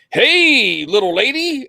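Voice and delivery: high, loud drawn-out hey; imitating video clerk. Clerk greeting a customer in a bad training tape, mock-friendly. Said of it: "the guy's just like".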